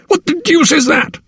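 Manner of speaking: very high, alarmed outcry; aghast high-pitched question; horrified exclamation. A narrator's reading. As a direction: demand it in horror, voice leaping high. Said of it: "he exclaimed".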